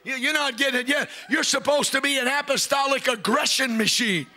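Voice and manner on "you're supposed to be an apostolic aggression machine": high shouting sermon cadence, slowed on the climax; preaching at full force. Acted. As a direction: preach it to the back row.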